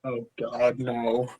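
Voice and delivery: whisper-like horror at the news; quiet dread. Retold or imitated. Nervous teen learning he must battle, quiet dread. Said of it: "And I was like".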